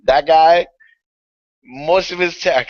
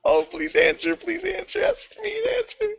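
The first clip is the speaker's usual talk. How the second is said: pleading; 3 dB quieter than usual. Real, not acted. Pleading the call connects.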